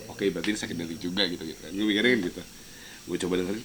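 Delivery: ordinary talk, nothing marked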